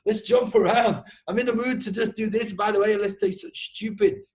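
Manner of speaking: high, loud and bouncy; playful hyped-up. Retold or imitated. Telling a podcast guest how he lets loose, high excited voice. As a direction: burst out like a kid ready to play. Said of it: "I also go at it with, like".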